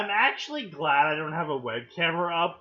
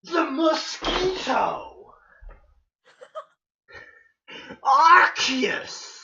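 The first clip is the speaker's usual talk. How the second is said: grand, drawn-out weapon reveals; hammed-up heroic titles; dramatic announcer; 6 semitones higher, 6 dB louder, much slower than usual. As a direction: proclaim each bow like a legend. Reads weapon names dramatically.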